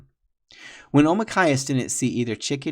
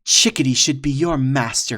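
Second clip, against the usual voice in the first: cried out; 3 semitones higher, 8 dB louder than usual. Acted. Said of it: "cried Two Strike".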